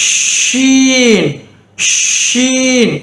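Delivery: ஷீன் begun with a long rush of sh; air-first sh. Shown as a demonstration. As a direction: push air out, then a long 'eeen' in ஷீன். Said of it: "என்று உச்சரிக்க வேண்டும்".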